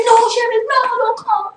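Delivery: high, loud frantic plea; sister's frantic cry. Retold or imitated. Sister crying out to Cheryl at knifepoint, high loud frantic plea. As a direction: cry out to stop her sister rushing in. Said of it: "And my sister said".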